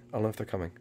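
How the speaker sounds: usual speaking voice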